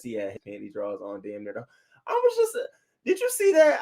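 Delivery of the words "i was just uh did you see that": high, scandalised squeal; shocked squeal. Real, not acted. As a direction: voice shoots way up in disbelief. Shock at the housemate's outfit.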